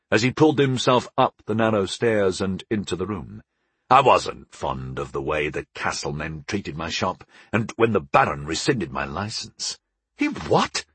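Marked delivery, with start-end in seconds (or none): 3.9-9.8 low, lightly voiced older dad; a hint of a grunting father, pitched down. A narrator's reading. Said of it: "my father grunted". 10.2-10.8 high, incredulous question; shocked. A narrator's reading.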